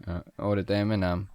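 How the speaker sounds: normal talking voice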